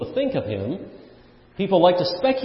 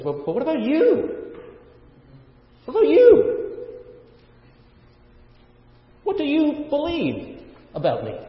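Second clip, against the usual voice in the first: Jesus asking; 10 semitones higher than usual. Retold or imitated. Jesus questioning disciples, voiced.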